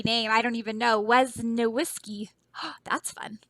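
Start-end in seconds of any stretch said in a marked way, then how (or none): 2.5-3.4 whisper. Real, not acted. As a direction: gasp then hushed unvoiced aside. Gasp then whisper.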